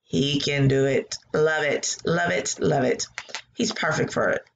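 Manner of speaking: normal talking voice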